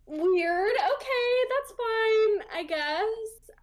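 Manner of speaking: baffled shrug. Retold or imitated. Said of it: "I was like".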